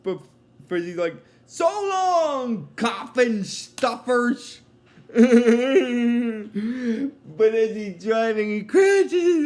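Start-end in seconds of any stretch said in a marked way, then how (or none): 1.5-4.6 cartoon voice. Cartoon character's taunting goodbye. 5.1-7.3 burst of laughter. Real, not acted. Laughs at own retelling.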